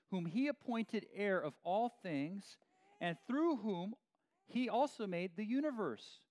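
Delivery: solemn Bible reading to the congregation; scripture reading. Acted. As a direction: read it out with weight and authority. Reads scripture aloud.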